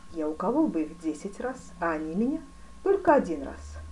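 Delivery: plain reading voice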